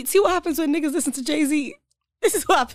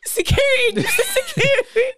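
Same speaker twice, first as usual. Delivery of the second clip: laughing mock call; 12 semitones higher, slower than usual. Calls security as joke.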